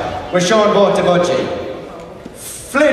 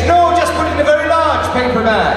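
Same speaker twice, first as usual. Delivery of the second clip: put-on Irish accent; 6 semitones higher than usual. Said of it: "He was Irish, not Scottish".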